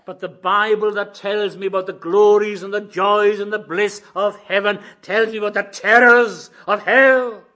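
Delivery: loud, high, emphatic sermon; impassioned preacher at full volume; full-force preaching. Real, not acted. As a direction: thunder it out to the congregation.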